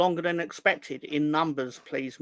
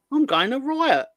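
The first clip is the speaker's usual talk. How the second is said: higher, louder mock indignation; mock outrage; 9 semitones higher, 5 dB louder than usual. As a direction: play up the fake fury. Mock outrage over cheese.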